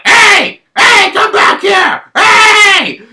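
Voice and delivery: put-on hollering after the runaway kid; angry teacher. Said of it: "he was going like".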